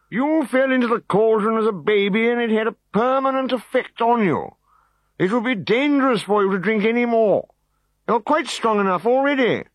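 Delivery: high tired lecturing druid; weary old druid. A narrator's reading. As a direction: explain for the hundredth time. Druid voiced wearily.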